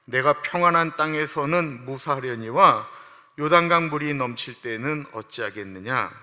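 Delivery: slow, loud, solemn recitation of the verse; loud scripture reading. Acted. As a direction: proclaim the passage to the whole church. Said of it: "시작". (회중과 함께 크고 장엄하게 성경 낭독)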